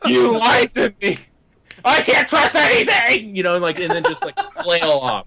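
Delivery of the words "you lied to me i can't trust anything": high, slow hammed-up sobbing; mock crying. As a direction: wail it like a betrayed hero. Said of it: "not like".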